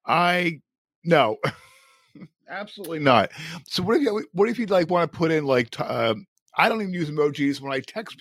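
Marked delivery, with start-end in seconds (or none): none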